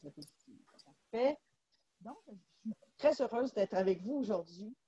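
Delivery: choppy dropouts, bad connection; glitchy call audio breaking up; sound cutting out. Real, not acted. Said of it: "le son coupe un peu". (son qui coupe, connexion hachée)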